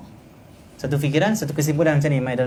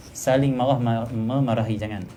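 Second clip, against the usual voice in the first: ordinary talk, nothing marked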